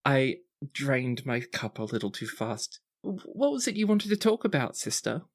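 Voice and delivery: put-on high, sweet, sniffly girl; a tipsy teen gushing then apologising; teary grateful sister. GM voicing Antonia hugging Juliana, high and sweet. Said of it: "you hear a sniffle".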